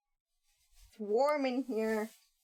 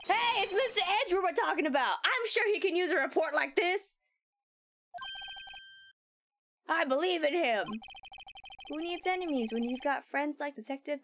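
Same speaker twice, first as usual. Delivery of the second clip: higher, gushing loyal detective; eager Gumshoe; 6 semitones higher than usual. Acted. Gumshoe defending Mister Edwards to his pal, gushing and higher. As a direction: sell him as a big believer in his boss.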